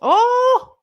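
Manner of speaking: high, loud startled oh; sudden shock at who's coming; surprised gasp. Real, not acted. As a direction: yelp of surprise. Sees Miss Elizabeth coming down.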